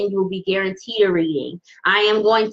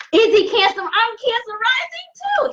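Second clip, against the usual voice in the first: high, delighted squeal of recognition; light up at the shared sign; thrilled; 13 semitones higher than usual. Real, not acted. Tarot reader on a live stream thrilled to share the sign.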